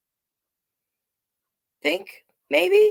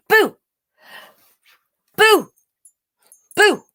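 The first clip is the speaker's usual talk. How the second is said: put-on much higher, louder baby boo; peekaboo boo; 4 dB louder than usual. Baby Jackie playing peekaboo, bright high boos. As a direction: pop out each boo like a toddler.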